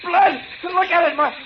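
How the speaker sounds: high, frantic cries; rising panicked shrieks; hysterical panic. Acted. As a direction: lose it at the sight of blood. Staring at his own blood.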